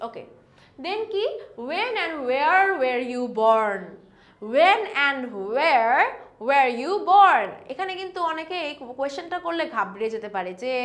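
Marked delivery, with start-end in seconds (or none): 1.7-7.5 very slow, every word laid out for learners; slow careful demo. Shown as a demonstration. Teacher to Bengali learners, slow model question. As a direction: spell the question out slowly and clearly. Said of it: "উচ্চারণটা কিন্তু ভালোভাবে খেয়াল করতে হবে".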